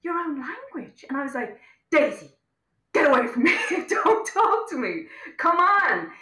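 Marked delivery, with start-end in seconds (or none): 0.0-1.0 mock-regal girl, pitched way up; posh royal airs. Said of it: "had all the airs and graces of, uh, almost like royalty". 1.9-6.1 raised, louder retort with drawn-out words; mock-outraged telling-off; exasperated brush-off. Acted retort to the posh girl.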